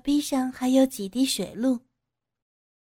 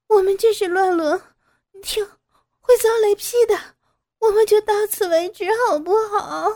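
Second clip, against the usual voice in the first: high, tearful begging that grows louder; desperate pleading; 10 semitones higher, 6 dB louder than usual. Acted. Daughter-in-law begs father-in-law to stop, desperate pleading. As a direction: plead in tears, building to a high, loud appeal. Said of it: "摇着头对着公公哀求着".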